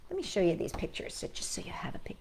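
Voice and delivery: soft unvoiced whisper aside. Real, not acted. Teacher showing pictures to children, whispered.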